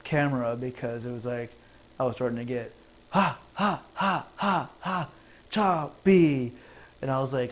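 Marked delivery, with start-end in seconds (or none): 3.1-6.5 mock choppy stuttering laugh, high. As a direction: chop it up like a glitching stream. Mocking choppy video stutter.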